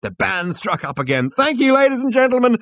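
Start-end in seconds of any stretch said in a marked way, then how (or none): 1.3-2.6 high, loud cabaret host; full-voiced stage thanks; showman's cry. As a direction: cry it out to the whole room. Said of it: "cried Max".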